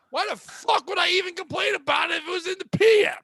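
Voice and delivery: high, exasperated near-shout; exasperated outburst. Retold or imitated. Comic retelling his annoyance at Dave's dumb question, exasperated outburst. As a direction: blurt it out in disbelief, voice climbing.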